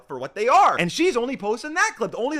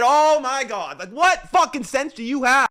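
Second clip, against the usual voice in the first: loud, raised-pitch rant; exasperated outrage; 6 semitones higher, 6 dB louder, slower than usual. Real, not acted. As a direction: burst out in real exasperation. Ranting at a double standard.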